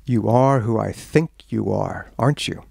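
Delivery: low, slow and knowing; coyly sizing someone up, drawn out. Retold or imitated. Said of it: "a very coy expression".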